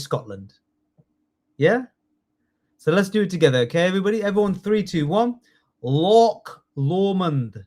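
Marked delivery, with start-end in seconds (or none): 5.8-7.6 very slow, drawn-out Scottish accent. Shown as a demonstration. Teacher leading the class in Scottish words, Scottish accent. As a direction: model a Scottish accent for repeating. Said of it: "it's a good Scottish accent".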